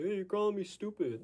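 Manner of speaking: high, put-on whiny protest; mock a touchy person taking offence; squeaky, offended caricature; whiny defensive mock. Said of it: "be like".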